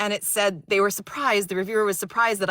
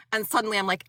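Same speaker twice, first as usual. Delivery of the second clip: ordinary talk, nothing marked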